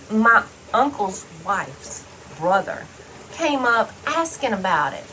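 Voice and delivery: ordinary talk, nothing marked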